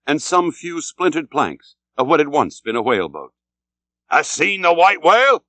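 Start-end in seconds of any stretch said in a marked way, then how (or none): 4.1-5.4 higher, louder shout across water; hailing call. A narrator's reading. Captain hailing the Delight's captain, loud call. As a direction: call out to the passing ship. Ahab hails another ship.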